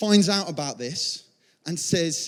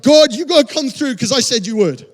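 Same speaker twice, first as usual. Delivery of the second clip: loud, higher, urgent demand; insistent plea; 5 semitones higher, 7 dB louder than usual. Acted. Believer calling on God to come through, insistent plea. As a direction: press God urgently, louder.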